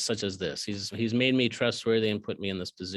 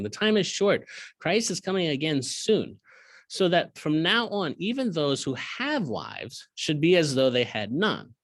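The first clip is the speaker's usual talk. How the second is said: lifted, higher reading voice; scripture reading; 4 semitones higher than usual. Acted. Teacher reading the verse to his students, raised pitch. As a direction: proclaim the verse.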